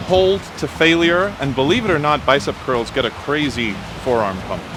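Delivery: ordinary talk, nothing marked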